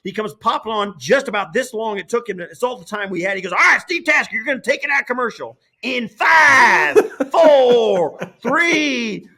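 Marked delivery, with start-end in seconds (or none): 3.5-9.3 high, shouted director's countdown imitation; imitating a truck boss yelling a live cue; Lance Barrow. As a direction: holler the cue and count down at full voice. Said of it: "he's screaming in the truck".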